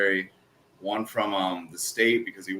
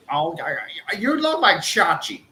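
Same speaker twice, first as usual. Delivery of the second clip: imitating older coworker; 8 semitones higher than usual. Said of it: "and he's like".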